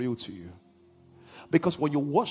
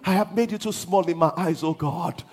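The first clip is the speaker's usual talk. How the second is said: high, loud, half-sung preaching build; preaching at full force; 8 semitones higher, 6 dB louder than usual. Acted. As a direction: lift the church into a shouted, chanted climax. Preaching climax, sung and shouted.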